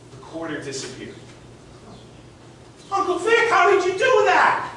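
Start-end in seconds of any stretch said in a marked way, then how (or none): 2.9-4.7 put-on much higher, wide-eyed kid's voice; a ten-year-old gasping at a magic trick; amazed little boy. Boy asks his uncle how the coin vanished, amazed high child voice.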